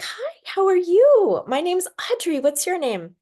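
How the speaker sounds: put-on high sweet voice introducing herself to a child; baby-talk to a toddler. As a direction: coo gently at a tiny girl.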